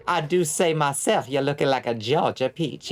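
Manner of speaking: hammed-up Southern belle accent, R's dropped; posh Savannah accent. Shown as a demonstration. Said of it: "That accent's nuts".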